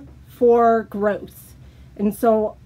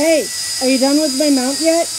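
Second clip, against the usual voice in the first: impatient nagging; 2 semitones higher, 6 dB louder, faster than usual. Acted. Impatient nagging teammate skit.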